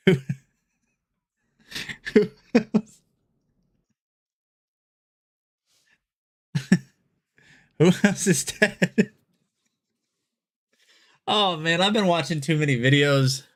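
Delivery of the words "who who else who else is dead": giggling, pitch jumping high; laughing. Real, not acted. Laughing at his own misread of the chat. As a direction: laugh through the line.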